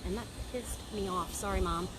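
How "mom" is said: ordinary talk, nothing marked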